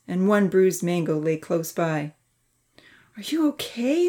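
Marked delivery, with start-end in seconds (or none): 3.2-4.1 high, concerned question; tearful call. A narrator's reading. Santa checks on the fallen Charlie, tearfully calling. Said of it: "Santa called out, tears in her dark eyes".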